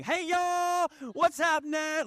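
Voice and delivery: high, hollered Southern greeting; full-on Southern accent. Shown as a demonstration. After one alcoholic beverage, exuberant full-on Southern accent. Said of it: "it's full on, just Southern accent embracing".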